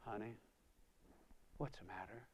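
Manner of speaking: very soft, gentle mother; tender concern. Retold or imitated. Mother holds her shaking son, tender soft concern. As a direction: comfort him softly.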